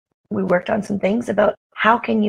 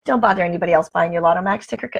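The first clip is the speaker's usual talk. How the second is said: ordinary talk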